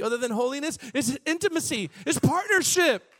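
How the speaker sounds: high, loud fervent preaching; preaching at full force. Acted. Preacher urging his people back to intimacy, loud and fervent. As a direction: press the longing home to them. Voices God's longing, preaching.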